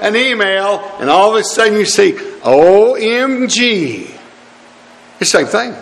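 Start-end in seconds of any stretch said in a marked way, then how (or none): 2.4-4.1 drawn-out, higher-pitched mock exclamation. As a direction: stretch each letter in mock astonishment. Mocks online exclamation he condemns.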